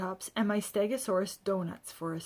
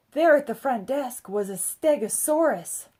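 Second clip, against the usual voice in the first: plain reading voice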